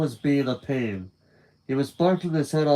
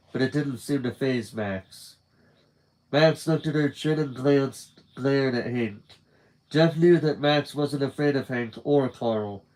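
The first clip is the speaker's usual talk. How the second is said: ordinary delivery, nothing marked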